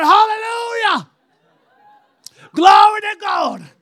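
high, loud, slow hallelujah shout; shouted praise. Retold or imitated. Preacher shouting praise to God before the congregation, very loud and high. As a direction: throw the praise up to the rafters.

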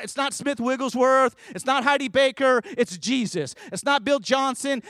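lifted, insistent sermon cadence. Acted. Preacher naming heroes to the church, insistent. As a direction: press the point home from the pulpit.

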